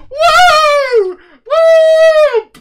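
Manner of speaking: very high, loud party whoop. Real, not acted. Streamer celebrating being up late with a whoop. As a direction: cheer like a party popper went off.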